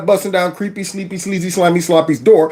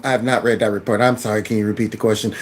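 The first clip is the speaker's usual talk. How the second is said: low, soft, bored parroting of her words; mocking imitation of her; 7 semitones lower, 5 dB quieter, faster than usual. Commentator parroting the official's evasive replies, low and bored. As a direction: drop low and quiet, play her as checked out. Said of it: "talking about some".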